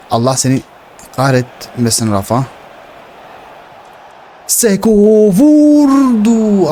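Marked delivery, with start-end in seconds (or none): 4.5-6.7 rising goal-chance shout; excited yell. Real, not acted. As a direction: scream the shot out. Striker shoots at goal.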